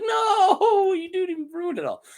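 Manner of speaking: hammed-up tearful protest; mock crying. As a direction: fake sob at a wrecked dinner. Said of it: "They're like".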